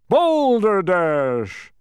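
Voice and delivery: loud, high, long-drawn bellow; booming shout. A narrator's reading. Beetle interrupts the bee's story, booming shout. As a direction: boom it out very slowly. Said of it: "shouted a booming voice".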